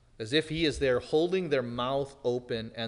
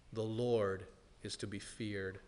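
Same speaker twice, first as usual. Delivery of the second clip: softer, low, solemn repeat of the refrain; hushed repeat; 5 semitones lower, 9 dB quieter than usual. Acted. Preacher quietly repeats the warning to his congregation, hushed. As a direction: let the line sink into a grave hush.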